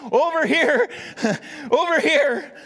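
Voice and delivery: put-on high, loud child voice calling out; children yelling for the twenty dollar bill, high and loud; excited kids shouting. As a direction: shout like an eager kid waving to be picked. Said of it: "the whole place starts shouting".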